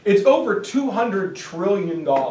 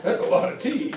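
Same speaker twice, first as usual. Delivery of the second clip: usual speaking voice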